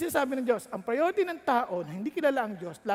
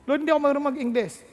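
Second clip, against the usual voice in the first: fast, higher pleading; anxious plea; 4 semitones higher, 5 dB louder, much faster than usual. Acted. Young pastor quickly pleading excuses to God. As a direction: rush the excuse out.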